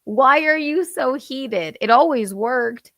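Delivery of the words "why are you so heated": high-pitched incredulous retort; amused disbelief. Retold or imitated. Giggling child answering a screaming mom, incredulous. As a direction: question it in raised, mocking disbelief. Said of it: "I'm like".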